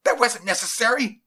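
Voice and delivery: high, loud, indignant outburst; heated objection; angry protest. A narrator's reading. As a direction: scold your partner sharply, voice jumping up. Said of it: "whispered in anger".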